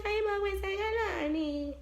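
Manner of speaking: mock-casual, pitched high; landlady's airy greeting, pitched high; breezy greeting. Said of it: "She's just like".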